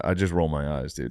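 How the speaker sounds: usual speaking voice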